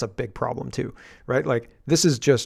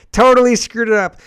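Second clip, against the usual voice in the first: much higher and louder, totally hammed up; mock shout; 10 semitones higher, 10 dB louder than usual. Mocking the minister's bungle, loud mock shout. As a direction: belt it out in mock glee.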